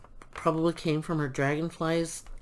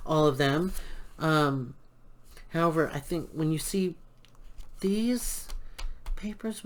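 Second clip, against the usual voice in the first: ordinary delivery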